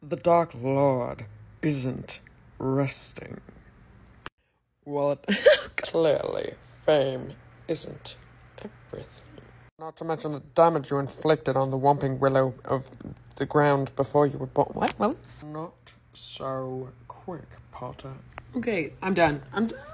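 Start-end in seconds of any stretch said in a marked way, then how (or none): 0.1-14.7 sick deep voice, measured menace with long pauses; Snape. Acted. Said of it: "we're gonna try and do a Snape impression". 15.4-18.1 very low, soft and drawn out; deep, hushed, stretched-out villain; slow every word right down, deep and soft; Snape. Acted. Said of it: "do a Snape impression".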